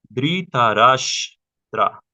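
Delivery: Dhritarashtra said slowly and loud, each syllable spelled out; careful Sanskrit name. Shown as a demonstration. Teacher sounding out the name slowly for the students. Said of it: "como si tuviera una H al lado".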